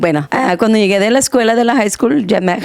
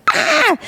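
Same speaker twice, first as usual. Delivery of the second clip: high yelp of pain; mock yelp. Wife retelling lime in her eye, high mock yelp. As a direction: cry out as lime hits your eye. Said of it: "I was like".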